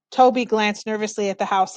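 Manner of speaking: usual speaking voice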